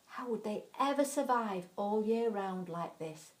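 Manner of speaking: ordinary delivery, nothing marked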